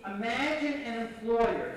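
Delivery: usual speaking voice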